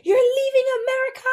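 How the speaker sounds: high, loud imitation of a panicked mom; mother shrieking in disbelief at the news; mom freaking out. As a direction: squeal it like a shocked mother. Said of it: "she was, like, freaking out".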